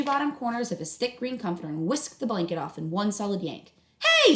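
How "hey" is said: high, loud startled shout; sudden loud protest; startled yell. Acted. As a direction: yelp as the blanket goes. Woken brother yells.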